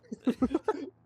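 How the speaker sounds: burst of laughter. Real, not acted. Fight on pit road.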